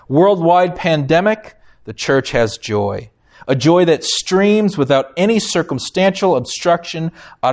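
measured, earnest reading voice; reading a quote aloud. Acted. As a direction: read it like treasured scripture. Reads a book quote aloud.

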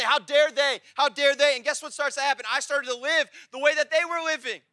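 driving, insistent sermon build; full-force preaching. Real, not acted. Preacher confessing the bitterness he carried, full-force preaching. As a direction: hammer each repeated phrase to the congregation.

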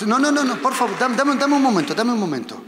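preacher stopping a volunteer, loud and urgent; urgent stop. Real, not acted. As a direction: halt them before they leave. Stops volunteer walking off.

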